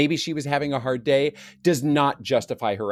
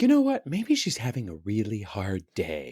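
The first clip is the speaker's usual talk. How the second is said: mock calm; 5 semitones lower, 4 dB quieter than usual. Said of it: "been like".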